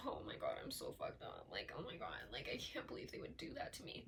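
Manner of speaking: hushed mocking whimper; mock self-pity. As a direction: whisper it like a pitiful victim. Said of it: "in the sense where you're like".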